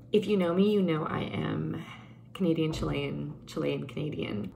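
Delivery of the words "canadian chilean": ordinary talk, nothing marked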